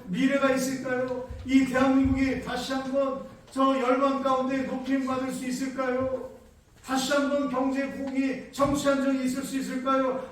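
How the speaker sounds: rising pulpit fervor; building preaching. Acted. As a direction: preach it harder line by line. Preaching builds to full force.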